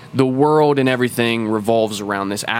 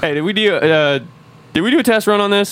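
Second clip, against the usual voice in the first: higher, fast and louder, a worker who just broke something; a hammed-up nervous technician; mock panic; 9 semitones higher, 3 dB louder, faster than usual. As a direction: blurt it out like you just noticed the mistake.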